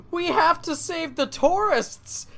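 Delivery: high, slow, earnest rallying cry; heroic Arthur voice. Retold or imitated. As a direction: call it out like a brave young hero. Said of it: "Arthur's like".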